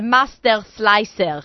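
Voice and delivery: imitated Israeli accent on the words. Host quoting the Israeli lady with her slicer, Israeli accent. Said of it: "said it with her Israeli accent".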